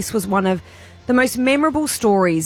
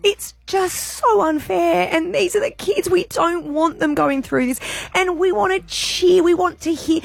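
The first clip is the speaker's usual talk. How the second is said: real tearful, high-pitched voice; choked up; 9 semitones higher than usual. Real, not acted. Radio host moved by replayed call from a mother, choked up. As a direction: speak through welling tears, pitched high. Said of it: "Still gets me. It got me again".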